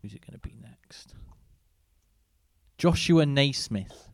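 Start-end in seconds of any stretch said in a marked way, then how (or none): 0.0-1.1 hushed suspense whisper. Acted. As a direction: whisper it like a secret. Suspense before the reveal.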